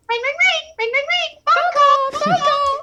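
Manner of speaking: very high, squeaky game computer. Acted. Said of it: "that computer voice that goes".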